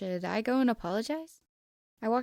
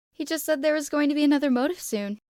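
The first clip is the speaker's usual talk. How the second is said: loud, higher eager blurt; exclaimed; 7 semitones higher, 8 dB louder than usual. Acted. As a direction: toss it out brightly and loud. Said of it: "I exclaimed".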